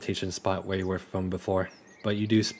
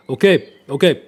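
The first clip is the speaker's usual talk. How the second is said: put-on high, loud accented voice; Riolus's accent; 7 semitones higher, 9 dB louder than usual. Riolus giving in under threat, in his high put-on accent. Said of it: "I'm giving you the accent".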